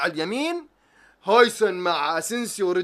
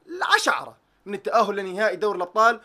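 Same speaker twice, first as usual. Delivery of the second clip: ordinary talk, nothing marked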